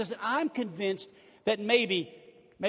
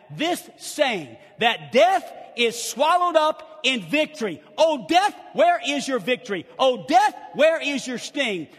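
loud full-voiced preaching cadence; 4 semitones higher, 7 dB louder than usual. Pastor preaching Corinthians to his church, loud full preaching voice.

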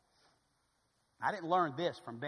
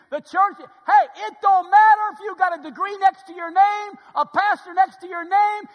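shouted, high-pitched sermon; loud, urgent pulpit exhortation; full-force preaching; 13 semitones higher, 18 dB louder than usual. Real, not acted. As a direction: drive it at every person in the pews.

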